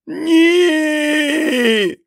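very high, loud terrified scream; the dark man screams as the hand comes; shriek. A narrator's reading. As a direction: shriek in mortal terror. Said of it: "заверещав темний чоловік".

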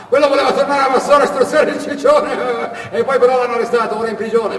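a bragging driver telling tall tales, words tumbling out; chatty truck driver Canna. Acted. Canna's long talkative monologue. (camionista chiacchierone e vanaglorioso, voce più alta e forte)

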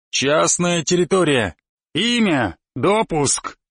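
muffled boom through a helmet visor; slow, muffled soldier voice behind a mask; booming from under helmet. Acted. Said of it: "гудел голос из-под шлема".

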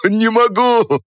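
высоко, сквозь хохот; выдохни сквозь смех; задыхаясь от смеха. Acted. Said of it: "задыхался Степан". (задыхается от хохота)

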